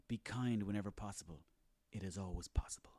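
low, hushed sage voice; mock wise quote. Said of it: "all these quotes like".

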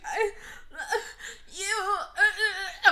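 high, slow mock sobs; imitated sobbing. Host plays [name] starstruck by [name], mock sobbing. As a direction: blubber like an overcome fan, played for laughs. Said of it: "like, sobbing".

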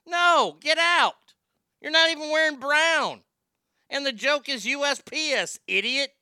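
high, loud mock outrage; indignant rejection. Homeowner turns away a trick-or-treater in a lame costume, indignant rejection. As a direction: send them packing, loud and high-pitched.

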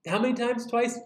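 high, offhand mock leniency; casual leniency. Jesus as Peter hopes to hear him, mock easygoing. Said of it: "he was hoping Jesus was gonna be like".

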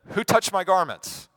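loud, high-pitched demand; calling out. Acted. Jesus turning to question the crowd, loud demand. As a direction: call it out over a crowd.

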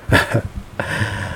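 soft, breath-only chuckle; short laugh. Real, not acted. Preacher owning up to crying before the congregation, soft brief laugh. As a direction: chuckle quietly to yourself.

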